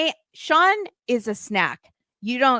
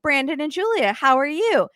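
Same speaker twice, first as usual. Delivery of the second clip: breezy host quote; 8 semitones higher than usual. Retold or imitated. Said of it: "they're like".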